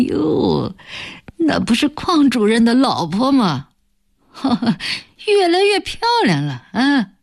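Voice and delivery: put-on high-pitched sneering old man's call with a snicker; mocking, wheezy jeer. A narrator's reading. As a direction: jeer at her with a wheezing laugh. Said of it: "带着讪笑喘息着叫道". (讪笑着、喘息着尖声挖苦)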